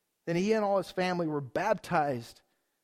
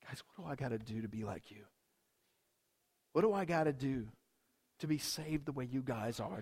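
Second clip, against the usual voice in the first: much lower and softer, hurried plea; humble pleading; 6 semitones lower, 8 dB quieter than usual. Pretend. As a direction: beg quietly, humbled. Jailer begging to be saved.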